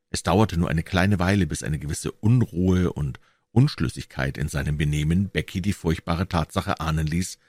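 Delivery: ordinary delivery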